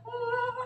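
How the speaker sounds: long high-pitched mock wail; drawn-out imitation of a crying woman; imitated wail. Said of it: "ibu kontrakan ini nangis".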